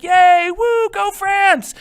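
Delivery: high, loud mock cheer of the crowd. Teacher voicing the French people cheering, high and loud. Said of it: "the French people are like".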